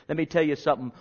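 usual speaking voice

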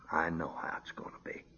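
hushed whisper, fast and certain. Real, not acted. Preacher whispering his certainty to the congregation. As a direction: drop to a near whisper, sure of it. Quiet confidence after eternity line.

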